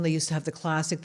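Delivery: usual speaking voice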